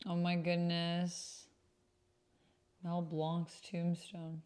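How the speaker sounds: normal talking voice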